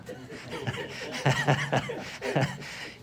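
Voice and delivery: amused, breathy laughing fit; laughter. Real, not acted. Teacher cracking up after his anecdote's punchline, laughing. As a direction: burst out laughing at your own punchline.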